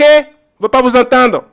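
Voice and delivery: high, loud refusal to listen; angry shout. Acted. Refusing to hear the inventors of lies, shouted. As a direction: shout them down.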